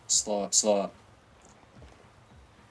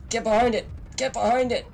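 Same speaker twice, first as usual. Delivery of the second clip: urgent shout; 11 semitones higher, 5 dB louder than usual. Real, not acted. Taking fire, urgent call.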